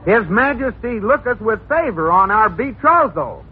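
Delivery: high, stiff theatrical reading; hammy stage actor. Acted. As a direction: overplay the knightly line. Husband reads stage line.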